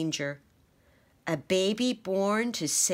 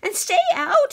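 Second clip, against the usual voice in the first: very high, loud squeak; a mouse banishing the statues; bossy squeaked command; firm mouse order; 10 dB louder than usual. A narrator's reading. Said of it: "he said".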